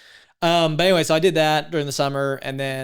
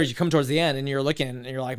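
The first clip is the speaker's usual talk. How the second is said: ordinary talk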